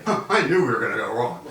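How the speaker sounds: fast, smug know-it-all jab; mock the teammate who saw it coming; smug told-you-so. Boss mimicking a smug teammate at the leadership table, told-you-so tone. Colleague's smug hindsight remark.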